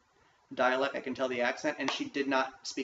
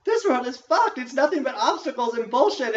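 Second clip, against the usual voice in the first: much higher, louder awakening cry; sudden realization; 10 semitones higher, 9 dB louder than usual. Retold or imitated. Quoting the awakening from the movie, sudden loud realization. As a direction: burst out as the truth hits. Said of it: "that you go".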